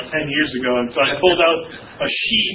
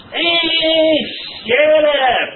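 high, drawn-out shout; cartoon outburst; 12 semitones higher, 4 dB louder, much slower than usual. Cartoon guy silencing someone who keeps complaining, shouting. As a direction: explode at a complainer like a cartoon character. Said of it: "and the guy goes".

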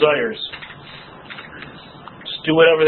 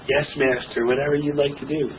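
lower, much softer groveling servant voice; bow and scrape to the old master; mock obedient servant; servile slave; 4 semitones lower, 6 dB quieter than usual. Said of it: "bring it back out of the grave and go".

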